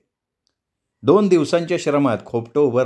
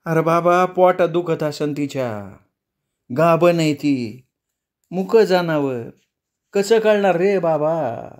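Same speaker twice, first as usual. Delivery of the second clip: put-on higher, slow, pleading old mother; 4 semitones higher, slower than usual. Amma begs her son to spare the dog, slow and pleading. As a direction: slowly plead for the dumb animal.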